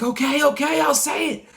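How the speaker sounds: high, loud desperate shout; panicked yell. Retold or imitated. Dreamer gives in to the figure, high frantic yell. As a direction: cry out giving in under pressure. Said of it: "I was like".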